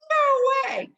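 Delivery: very high, loud squeal of no way; shrieking disbelief. Retold or imitated. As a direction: shriek it out in shock.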